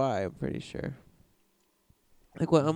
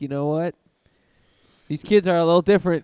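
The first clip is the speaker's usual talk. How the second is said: fast, loud mock proclamation; mock official voice; 9 dB louder, faster than usual. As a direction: announce it like a pompous expert. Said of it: "people are just like".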